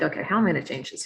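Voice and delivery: fast, tumbling worried question; rushed worried thought. Retold or imitated. Facing burned-out nurses, a fast worried question. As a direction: rattle it off in a hurry. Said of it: "So I was like".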